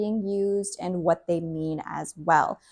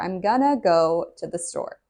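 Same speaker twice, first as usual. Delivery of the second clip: normal talking voice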